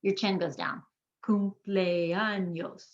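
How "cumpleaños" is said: very slow "Cumpleaños", four distinct beats; syllable by syllable. Shown as a demonstration. Teacher counting chin drops, "Cumpleaños" slowly in four parts. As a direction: draw out each syllable of "Cumpleaños".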